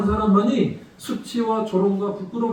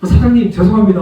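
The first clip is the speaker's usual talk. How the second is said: urgent, louder apology with a falling end; 3 semitones higher, 9 dB louder than usual. Retold or imitated. Father apologizing to the angry car owner, loud and urgent. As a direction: blurt out sorry to the boss. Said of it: "너무 미안해서".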